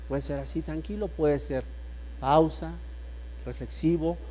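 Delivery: calm, reflective. Shown as a demonstration. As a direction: say it calmly, pausing to reflect. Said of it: "tranquilo, reflexivo". (tranquilo y reflexivo)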